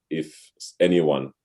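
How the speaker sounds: ordinary delivery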